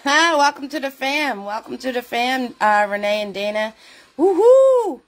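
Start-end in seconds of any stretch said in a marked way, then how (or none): 4.2-5.0 high, loud woohoo; excited cheer. Real, not acted. Host cheering new viewers into the family, loud and high. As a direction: cheer them in like a party host.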